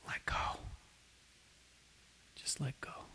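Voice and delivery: soft breathy whisper, very gentle. Acted. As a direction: whisper it tenderly. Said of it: "very gently says".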